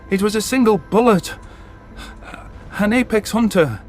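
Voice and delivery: plain reading voice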